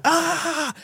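high-pitched drawn-out "aah"; mock yell of helpless frustration; frustrated yell. Imitating the Point Break gun scene, mock high yell. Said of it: "shoot the gun in the air".

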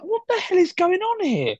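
high-pitched incredulous outburst; exasperated. Real, not acted. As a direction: protest in comic exasperation, voice shooting up. Exasperated rant about meal names.

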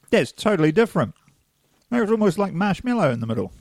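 talking with a mouthful; chewing while talking. Real, not acted. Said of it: "I'm gonna try the new one now".

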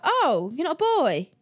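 retold surprise with a big swooping pitch; mock surprise. Casting people discover she isn't a boy, mock surprise. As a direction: imitate a taken-aback "oh", swooping high.

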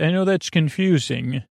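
ordinary delivery

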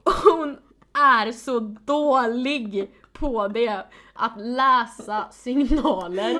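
loud, very slow motherly lecture; sounding like a mom. Real, not acted. Teasing her co-host like a lecturing mother, loud and slow. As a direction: lay it on like a worried mother. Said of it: "du låter som min mamma".

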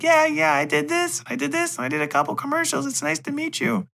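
high mock child voice; kid voice. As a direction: speak like an eager little boy. Voicing the child actor.

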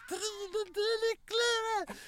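very high, long drawn-out name call; mock announcer shout. Co-host mimics her calling out a judge, drawn-out yell. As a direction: stretch the name into a screaming introduction. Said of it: "Det hadde aldri blitt ropt".

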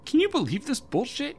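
very high, squealing disbelief; outraged disbelief. A narrator's reading. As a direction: shriek it at your husband. Debbie appeals to her husband.

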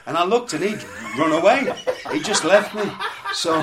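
loud laughing through the words. Real, not acted. Still laughing about being left alone at the grave. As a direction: laugh while you tell it.

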